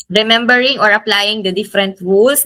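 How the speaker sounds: normal talking voice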